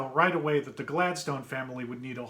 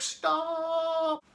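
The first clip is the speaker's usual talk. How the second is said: high, stretched cry of stop; panicked yell; 13 semitones higher than usual. Acted. Dad chasing the runaway tractor, high panicked yell.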